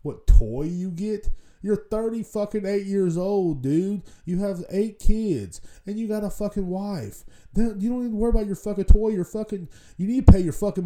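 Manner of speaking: heated disbelieving tirade; exasperated rant. Real, not acted. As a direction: lay into them, drawing out the first lines. Ranting at grown customers.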